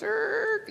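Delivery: very high, short screech; high-pitched "erk" noise; imitated screech. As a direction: mimic a sudden squeal. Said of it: "and I heard this".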